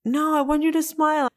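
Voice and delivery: high-pitched imitation of insistent husband; playfully insist, pitched well up; retold high, coaxing insistence. Retold husband insisting on cheering her.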